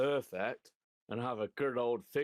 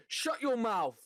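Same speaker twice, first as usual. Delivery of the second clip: put-on high, loud little boy's command; four-year-old son ordering dad, hammed-up high voice; imitating his son; 11 semitones higher, 4 dB louder, much slower than usual. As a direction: squeak it out like a bossy toddler. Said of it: "when he said".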